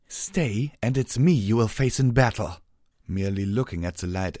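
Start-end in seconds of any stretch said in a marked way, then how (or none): 0.1-2.6 stern. Acted. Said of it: "Celestia said sternly".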